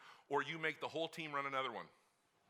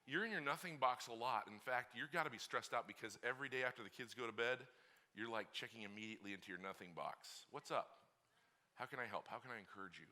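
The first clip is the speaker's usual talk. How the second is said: gentle wife; 5 semitones lower, 6 dB quieter than usual. Retold or imitated. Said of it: "she's like".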